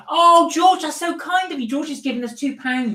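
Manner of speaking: delighted thanks. Real, not acted. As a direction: gush over the donation. Thanking a donor on stream.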